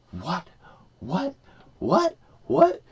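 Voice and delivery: escalating incredulous squeaks; acted disbelief. Prophet finding himself at Nineveh, mounting high-pitched disbelief.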